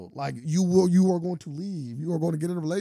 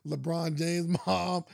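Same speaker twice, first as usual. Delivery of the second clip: usual speaking voice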